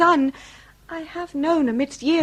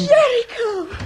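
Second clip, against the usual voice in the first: higher, louder cry of delight; joyful cry; 9 semitones higher, 5 dB louder than usual. Acted. Lady greets her arriving lover with a joyful cry. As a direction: call his name, overjoyed.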